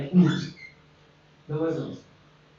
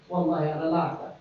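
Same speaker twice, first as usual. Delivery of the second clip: mock pleading patient; 6 semitones lower, much faster than usual. Patient refusing more pills.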